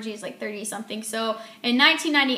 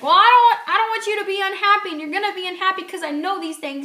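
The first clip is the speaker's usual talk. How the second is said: much higher, louder, fast distressed plea; upset outburst; 8 semitones higher, 6 dB louder than usual. Retold or imitated. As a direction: burst out like a girlfriend losing control. Upset girlfriend on phone.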